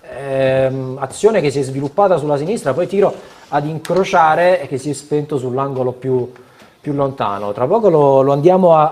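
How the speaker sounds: excited live play-by-play, loud and high; breathless italian goal commentary. Real, not acted. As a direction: call the equaliser like a live goal. Live goal call during broadcast. (telecronaca concitata del gol)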